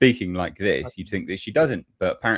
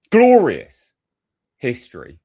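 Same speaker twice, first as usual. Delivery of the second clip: a sudden outraged shout; shouted glorious; 2 semitones higher, 9 dB louder, slower than usual. Real, not acted. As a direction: bellow the word glorious. Ranting about British history.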